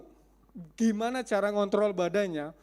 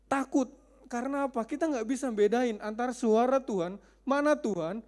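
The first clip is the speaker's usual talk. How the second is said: ordinary delivery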